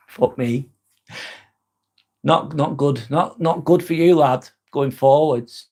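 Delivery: normal talking voice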